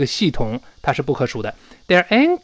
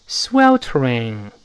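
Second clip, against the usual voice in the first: "sweltering" again, very slowly, as a model for repeating; slow careful "sweltering"; 3 semitones lower, much slower than usual. Shown as a demonstration. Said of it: "再读一下".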